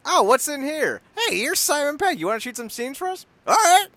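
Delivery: high, goofy voice of a casual studio exec; mock studio tour guy. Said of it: "and he's just like".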